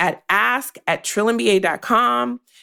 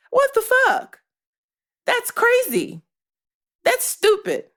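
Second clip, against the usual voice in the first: exasperated outburst; 13 semitones higher than usual. Real, not acted. Outraged at unfair pip.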